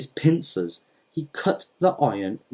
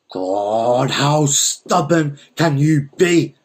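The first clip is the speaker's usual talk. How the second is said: exasperated exclamation; 3 semitones higher, 4 dB louder, much slower than usual. A narrator's reading. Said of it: "exclaimed Don Lal".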